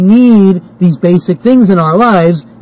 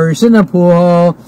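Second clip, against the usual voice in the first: ordinary talk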